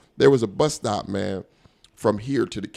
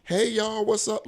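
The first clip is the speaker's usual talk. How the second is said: loud woman; 12 semitones higher, slower than usual. Retold or imitated. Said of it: "she's talking like there's no show going on".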